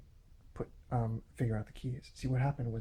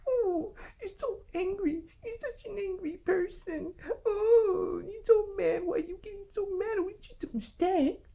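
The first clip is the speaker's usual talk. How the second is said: very high, whiny falsetto caricature; mocking critics. Imitating their response to his complaint, mocking falsetto.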